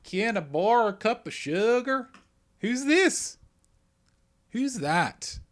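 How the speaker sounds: high put-on neighbor with an accent; on-the-spot character voice. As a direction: ham up a neighbor wanting sugar. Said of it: "the voice is not a clue".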